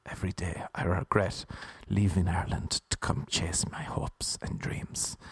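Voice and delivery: low, hushed, bleak whisper; whispered gloom. Retold or imitated. To morning radio host, a bleak whisper. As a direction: breathe it like a sad confession. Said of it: "I would just be like".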